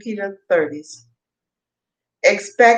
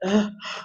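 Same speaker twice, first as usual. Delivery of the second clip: yawn; 7 dB quieter than usual. Real, not acted. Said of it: "That yawn just came out of nowhere".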